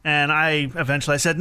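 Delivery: normal talking voice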